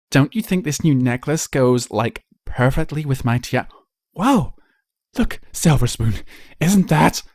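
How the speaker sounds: gush, then gasp in surprise; bratty schoolfilly spotting a celebrity; spoiled filly. Acted. Young filly chatter.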